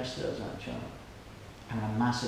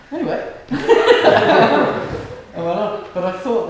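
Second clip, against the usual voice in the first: laughing; 10 semitones higher, 16 dB louder than usual. Real, not acted. Laughing after the story.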